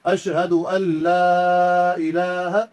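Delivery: لا drawn out for two to three alifs, slow chanted; long madd on la. Shown as a demonstration. Teacher shows the correct recitation, la stretched long. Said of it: "دو سے تین الف کے برابر کھینچ کے".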